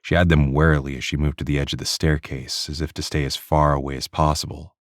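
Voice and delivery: plain reading voice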